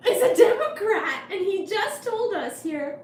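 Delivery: high, loud, laughing excitement; excited. Real, not acted. Exclaiming to chat about Doro, high and laughing. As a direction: blurt it out through giggles.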